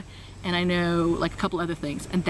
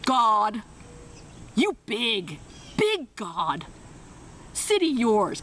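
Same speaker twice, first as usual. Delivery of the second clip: lightly mimicked halting prayer; a hint of mocking imitation; much slower than usual. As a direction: tease by parroting the prayer. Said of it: "She was like".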